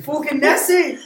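high, loud Scottish yell; over-the-top Scottish bellow; hammed-up Scottish accent. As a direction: shout it in broad mock Scottish. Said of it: "getting more like Conor McGregor".

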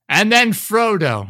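high, slow, drawn-out announcement; exaggerated storybook sing-out; mock epilogue narrator. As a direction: stretch out yet another ending. Parodies the never-ending movie ending.

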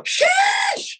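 very high drawn-out shouted sheesh; mock viewer shout. Mocking viewers who yell the term, very high shout. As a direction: squeal it like a hyped chat. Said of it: "this kind of reactionary term that people say".

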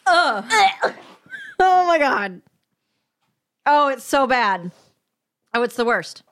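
retching on a harsh drink; gagging. Real, not acted. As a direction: gag and groan after swallowing. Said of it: "listeners who don't like retching noises".

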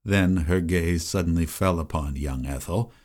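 ordinary talk

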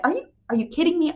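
high, fast, fed-up cry of disbelief; exasperated disbelief. Retold or imitated. Mom scolding herself after burnt carrots, high exasperated disbelief. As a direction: throw up your hands at yourself. Frustrated self-talk after burning dinner.

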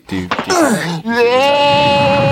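high, slow, alarmed cry. Real, not acted. Alarm at a car backing out.

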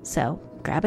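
hushed whisper to the audience. Acted. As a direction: lean in and whisper it. Spooky host leaning in.

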